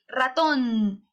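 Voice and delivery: ratón with a drawn-out, stressed TÓN; stress on last syllable. Shown as a demonstration. Teacher asking where the force is, ratón stressed on TÓN. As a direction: lean long on the tón of ratón. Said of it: "¿En dónde se encuentra la mayor fuerza?".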